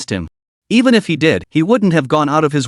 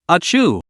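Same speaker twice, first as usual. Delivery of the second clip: sneeze; 3 semitones higher than usual. Acted. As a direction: let out a sharp sneeze. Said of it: "sneezed and shuddered involuntarily".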